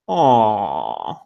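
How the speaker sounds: long, falling fake "aw" of pity; feigned pity, drawn out; mock sympathy. Host feigns pity for academics competing for space, mock sympathy. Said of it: "That's my sympathy face".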